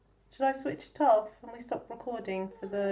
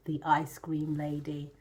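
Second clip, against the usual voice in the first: whispering; 9 semitones lower, 5 dB quieter than usual. Acted. Whispered title, secret tone.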